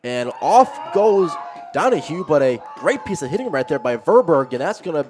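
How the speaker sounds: excited play-by-play. Real, not acted. Play-by-play of a hit and run.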